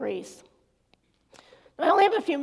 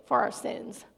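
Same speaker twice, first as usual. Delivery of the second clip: mock eye-roll answer; 5 dB quieter than usual. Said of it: "I rolled my eyes and was like".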